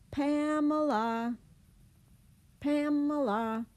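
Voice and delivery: long, loud, high call of "Pamela"; calling a child home. Retold or imitated. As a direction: stretch "Pamela" slowly and loudly, stressing "Pam". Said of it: "calling your child home for dinner".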